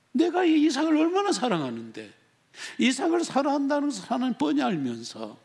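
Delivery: high, loud aggrieved appeal; acted protest. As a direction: plead the injustice, pitched well up. Acting out Abraham's protest to God.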